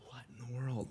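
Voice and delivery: barely audible disbelief; stunned aside. Retold or imitated. Guest recalling being asked which books he read, stunned and very quiet. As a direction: say it under your breath, stunned. Caught out by mentor's question.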